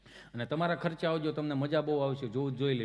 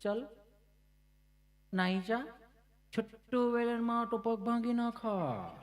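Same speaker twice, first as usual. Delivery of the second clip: higher, slow, put-on auntie voice; fancy-talking auntie; 6 semitones higher, slower than usual. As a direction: play an auntie trying to sound posh while shooing a dog. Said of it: "માસી વળી આમ એટલું fashion બોલે".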